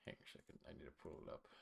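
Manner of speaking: usual speaking voice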